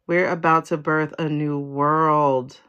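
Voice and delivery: slow, deliberate proclamation; slow, weighty. Real, not acted. Reader repeating the new-world news, slow and weighty. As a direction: draw out every word for weight.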